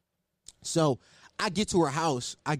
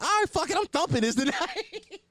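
much higher, laughing through the decision; giddy resolve; 13 semitones higher than usual. Retold or imitated. Telling the crowd about his hookup decision, high and laughing. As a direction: cackle as you give yourself permission. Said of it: "I'm like".

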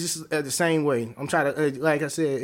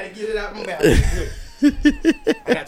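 laughing; 8 semitones higher, 7 dB louder than usual. Real, not acted. Laughing at his gum mishap.

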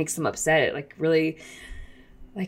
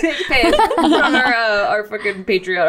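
laughing; 5 semitones higher, 4 dB louder than usual. Real, not acted. Joking about paywall while laughing.

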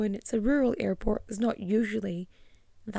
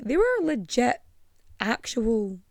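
ordinary talk

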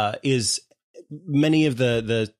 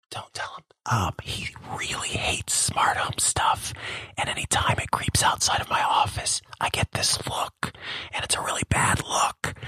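hushed secret whisper; 5 semitones lower, 4 dB quieter than usual. Real, not acted. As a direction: whisper it so he won't hear. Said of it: "Why are you whispering?".

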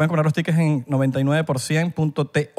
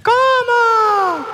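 high shriek; 4 dB louder than usual. Real, not acted. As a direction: shriek it in mock shock. Shrieked reaction to question.

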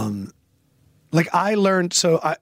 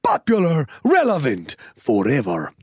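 put-on Spanish voice; slower than usual. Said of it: "Now I'm going into that".

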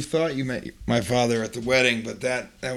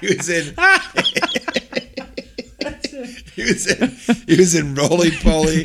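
laughing; 5 semitones higher than usual. Real, not acted. Laughing through the list.

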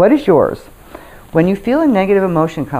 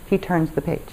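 ordinary talk, nothing marked

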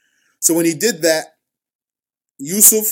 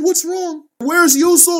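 much higher, urgent cry for the missing son; alarmed father; 12 semitones higher, 4 dB louder than usual. Acted. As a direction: call out in rising panic, pitched high.

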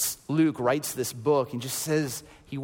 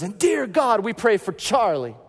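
high, loud fervent prayer voice; loud praying man; 6 semitones higher, 4 dB louder than usual. Retold or imitated. Praying man calls out over Tony, loud and fervent. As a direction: pray out like a revival preacher.

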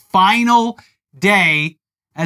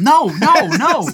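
high, raised-voice repeated no; emphatic denial; 7 semitones higher, 4 dB louder, slower than usual. Real, not acted. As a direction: cut off the wrong guess emphatically. Rejecting co-host's wrong name guess.

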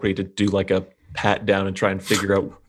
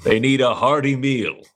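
rising, louder, jovial boast; hearty bluff; 6 semitones higher, 3 dB louder than usual. Acted. Caterer bluffing the guards with hearty cheer. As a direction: sell the cover story with booming cheer.

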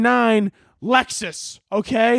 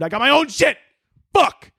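exasperated screaming; 7 semitones higher than usual. Real, not acted. Comic fed up with favor requests, exasperated outburst. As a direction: let irritation burst into a yelp. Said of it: "instead of just screaming at people".

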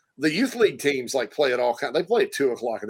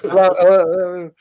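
echo effect; 4 semitones higher, 6 dB louder than usual. Retold or imitated. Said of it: "There's an echo".

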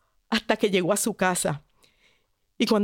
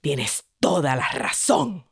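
proud father; 9 semitones lower than usual. Acted. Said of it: "bien orgulloso".

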